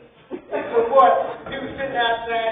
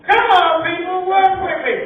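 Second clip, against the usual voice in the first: pleading with crowd; 8 semitones higher, 8 dB louder than usual. Real, not acted. Comic begging crowd for laughs.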